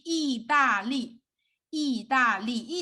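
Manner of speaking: 意大利 drawn out in three clear beats; teacher sounding out 意大利; syllable by syllable. Shown as a demonstration. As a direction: separate and emphasize each syllable of 意大利. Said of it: "break down of each syllable, emphasize it".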